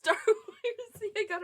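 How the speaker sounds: high, loud laughing question; laughing at your own mix-up. Real, not acted. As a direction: blurt it out through laughter. Realizes her mix-up, laughing.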